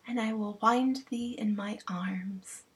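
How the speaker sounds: slow, soft whispered queen; tender whisper. Acted. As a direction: whisper it lovingly.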